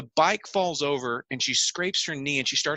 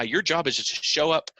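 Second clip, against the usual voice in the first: ordinary delivery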